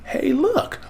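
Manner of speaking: high-pitched pretend discovery; mock surprise. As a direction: jump up high in playful amazement. Repeats the feigned discovery.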